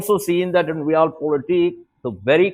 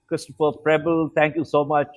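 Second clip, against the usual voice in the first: ordinary talk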